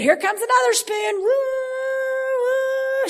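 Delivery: high playful feeding call; sing-song spoon coming to a baby; airplane spoon game. Pretend. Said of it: "And the Holy Spirit".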